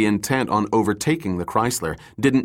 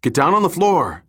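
urgent yell, higher and louder; 6 semitones higher, 3 dB louder than usual. A narrator's reading. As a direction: bark the order urgently. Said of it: "Dirk yelled at Sarah".

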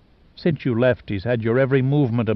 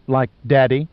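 louder, appalled question; outraged, fearful cry; 5 dB louder than usual. A narrator's reading. Maeve fears for her sons' future, crying in outrage. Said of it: "Maeve cried in outrage and fear".

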